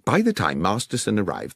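ordinary delivery, nothing marked

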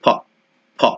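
ফ with a breathy f. Shown as a demonstration. Teacher showing ph sounds like f. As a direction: blow a clean ফ. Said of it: "এটাকে বলতে হবে ফ".

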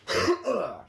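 short throaty cough; announced cough. Real, not acted. Warning listeners he is ill, then coughing. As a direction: cough away from the conversation. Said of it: "I'm gonna do one now".

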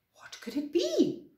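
puzzled, surprised storybook voice. Acted. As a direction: ask in acted surprise, pitch swooping up. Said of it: "said Floppy in surprise".

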